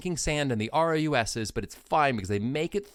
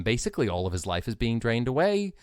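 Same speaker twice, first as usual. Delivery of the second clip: ordinary talk, nothing marked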